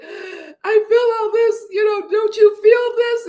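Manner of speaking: retold movie heroine crying out in the taxi; imitating a tearful cry. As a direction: wail it like she's breaking down in the taxi. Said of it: "she's like".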